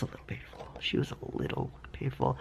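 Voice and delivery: whispering. Real, not acted. Whispered pain aside.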